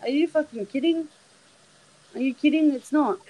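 high, disbelieving outburst; incredulous. Retold or imitated. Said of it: "and I went".

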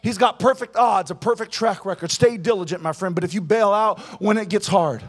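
loud, high, rhythmic sermon build; full-force preaching. Real, not acted. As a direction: drive every promise home to the congregation.

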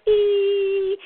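E held long, high and loud; long smiling E. Shown as a demonstration. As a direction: belt out one sustained E. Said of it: "so it's like a smile".

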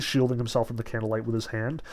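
ordinary delivery

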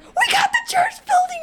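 high, loud, laughing shout of joy; joyful shout. Retold or imitated. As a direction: burst in with great news. Dad shouting the news.

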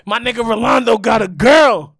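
loud, high hype for a friend; proud cheer. Pretend. Cheering a friend who finally got a girl, loud and high. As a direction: celebrate him out loud.

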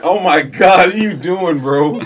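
high, loud disbelieving outburst; loud, high incredulous shout; excited exclaim. Real, not acted. Exclaiming at cohost's confusion.

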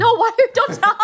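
high, loud laughing no; laughing protest. Real, not acted. Begging her friend not to tell, laughing. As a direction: squeal it out while laughing.

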